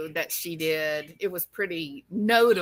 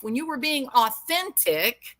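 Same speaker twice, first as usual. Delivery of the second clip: ordinary talk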